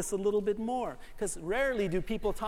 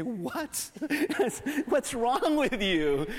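louder, slow mock-indignant disbelief; playfully scandalized at a baseball hater; mock outrage; 4 semitones higher, 4 dB louder, much slower than usual. Preacher answering someone who hates baseball, mock indignant disbelief.

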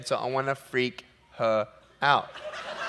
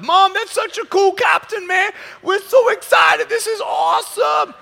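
put-on high, loud, thrilled child voice; excited kids; 7 dB louder than usual. Kids telling mom about the captain, thrilled. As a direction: squeal it like an excited kid. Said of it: "The kids are so excited".

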